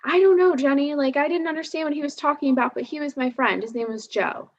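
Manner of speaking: put-on a girl earnestly defending her friend, rushing then trailing off; mom as a kid. Narrator voicing her mother as a kid defending Joe, high and fading. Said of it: "my mom was like".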